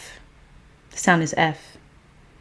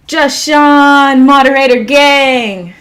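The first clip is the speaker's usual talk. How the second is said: loud, raised, stretched-out greeting; hyped shout-out; 7 semitones higher, 7 dB louder, slower than usual. Real, not acted. Streamer welcoming a moderator to the livestream, loud hyped call. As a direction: announce them like a big arrival.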